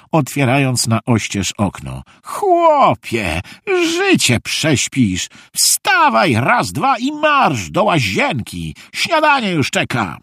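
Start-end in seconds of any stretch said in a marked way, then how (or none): 2.2-10.2 high-pitched, stretched-out commands; rousing wake-up call. A narrator's reading. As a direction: call out like a grandfather chivvying a sleeper. Grandpa rouses the boy from bed. (wysokie, energiczne wołanie na pobudkę)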